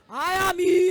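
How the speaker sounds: loud announcing voice of the period; period arriving. Pretend. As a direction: boom in like a grand guest arriving.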